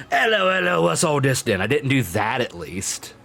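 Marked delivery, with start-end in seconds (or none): 0.1-1.6 higher, louder stock British constable; cliché British bobby. Shown as a demonstration. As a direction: ham up the classic British police catchphrase. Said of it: "At least I didn't go around".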